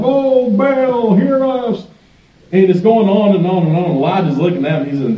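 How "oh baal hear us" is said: high, drawn-out wail to a god; hammed-up pleading cry, high but not full volume; mock screaming. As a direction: imitate frantic worshippers crying out. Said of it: "They're screaming out loud".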